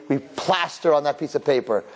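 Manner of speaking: normal talking voice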